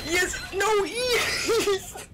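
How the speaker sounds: high, laughing yes and no shouts; excited yelling. Real, not acted. Tense game moment, laughing.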